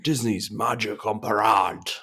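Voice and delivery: mock French announcer voice; French accent. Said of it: "apologise in advance for butchering the French language".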